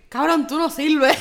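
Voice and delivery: loud, slow scornful yell; mocking shout. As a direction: bark it at the beaten fighter. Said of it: "que el referee diga". (gritando con desprecio)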